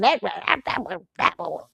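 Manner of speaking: unvoiced hushed whisper; whispered awe. Real, not acted. Recalling the famous sermon, hushed whisper. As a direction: whisper it in reverence.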